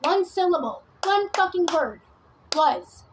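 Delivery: high, loud, hammered phrases ending on "Was"; emphatic "Was". Real, not acted. Insisting on the one word she lands on, emphatic "Was". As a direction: hammer each short phrase, landing hard on "Was". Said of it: "Emphasis on the word was".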